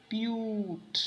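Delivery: "प्यूट" with a long, held vowel; stressed प्यूट. Shown as a demonstration. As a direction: draw out "प्यूट" as the stressed syllable. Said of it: "stress है". ("प्यूट" को खींचकर ज़ोर से उच्चारण)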